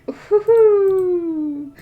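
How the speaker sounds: high, loud, drawn-out "whoo-hoo"; exasperated hoot. Real, not acted. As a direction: wail it out in comic despair. Exasperated over a disliked character.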